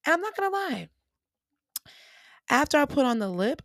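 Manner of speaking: normal talking voice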